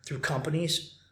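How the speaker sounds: ordinary talk, nothing marked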